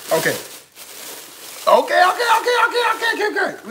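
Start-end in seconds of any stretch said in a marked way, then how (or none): 1.7-3.7 high, laughing string of okays, barely contained; giddy excitement. Real, not acted. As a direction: squeal it out, losing composure in delight. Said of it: "We gotta calm down".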